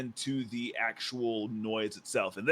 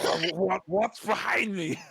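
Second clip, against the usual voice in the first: stammering, high-pitched confused orc; 5 semitones higher, 4 dB louder than usual. Acted. Orc reacting to pointing behind him, stammering confusion. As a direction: stumble in, then stretch the question high. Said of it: "He's like".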